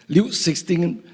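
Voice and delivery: ordinary talk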